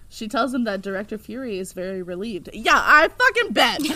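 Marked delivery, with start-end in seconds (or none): 2.5-3.8 loud high scoffing shout; sarcastic outburst. Real, not acted. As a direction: scoff it out angrily. Scoffing at Fury's relief.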